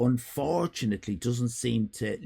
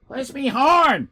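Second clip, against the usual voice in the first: higher, louder, slow call of "horn"; 12 semitones higher, 7 dB louder than usual. Real, not acted. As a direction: call "Where's me horn?" across the room, drawing out "horn". Calling out while searching.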